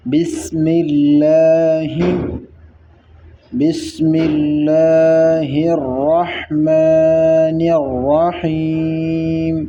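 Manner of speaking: slow, drawn-out tajweed recitation of بسم اللہ الرحمن الرحیم; Quran recitation. Shown as a demonstration. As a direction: chant بسم اللہ الرحمن الرحیم slowly with tajweed, elongating each vowel. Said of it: "تجوید کے ساتھ اس طرح پڑھنا ہے". (تجوید کے ساتھ آہستہ تلاوت)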